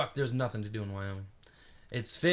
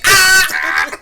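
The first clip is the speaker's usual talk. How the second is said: loud high yelp; high shouted ah; mock shocked yelp; 19 dB louder than usual. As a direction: yelp like you got burned. Reaction to insult.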